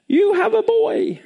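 high, drawn-out announcement; glad news. Acted. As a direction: proclaim a birth with acted delight. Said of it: "making him very glad".

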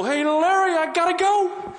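nervous hostage cutting the call short; panicked. Retold or imitated. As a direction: blurt it out scared stiff. Panicked victim hanging up.

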